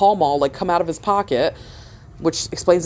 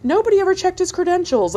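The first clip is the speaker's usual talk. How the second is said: higher, lightly hammed-up Dateline reporter; mock news anchor; 8 semitones higher than usual. Imagining a Dateline episode about the dentist, mock anchor. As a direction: play a lightly mock TV exposé voice. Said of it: "they're like".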